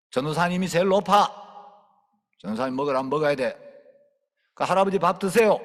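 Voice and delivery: loud, brisk orders; commanding. Retold or imitated. Preacher ordering the whole family to eat, loud and firm. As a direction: lay down the law.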